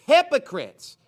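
high, loud accusation; shouted rebuke. Acted. Preacher reading Christ's charge against the Pharisees, shouted. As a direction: shout it as a condemnation.

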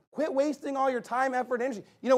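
usual speaking voice

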